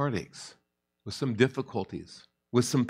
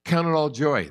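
voicing James; 6 semitones higher than usual. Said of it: "And he's saying to them".

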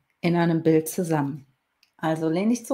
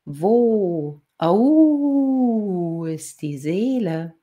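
howling wolf; 3 semitones higher, 3 dB louder, much slower than usual. A narrator's reading. Wolf reminds the girl of his question, howling. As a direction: break into a wolf howl, then settle into words.

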